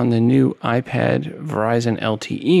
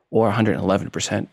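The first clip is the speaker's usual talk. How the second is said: usual speaking voice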